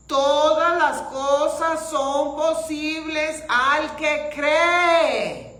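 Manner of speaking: slow, building to a loud cry; preaching shout. Real, not acted. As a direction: proclaim it loud and slow to the congregation. Preacher's climax, shouting the promise.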